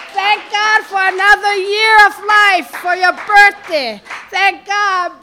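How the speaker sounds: loud, high-pitched calls to praise; exultant preaching. Real, not acted. Preacher urging brothers and sisters to thank God, exultant. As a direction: ring out each thanks to God.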